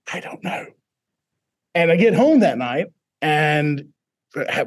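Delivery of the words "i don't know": bewildered whisper. Retold or imitated. Baffled reply to student.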